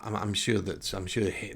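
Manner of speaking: ordinary delivery, nothing marked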